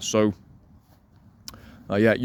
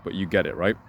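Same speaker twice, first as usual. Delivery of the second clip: ordinary talk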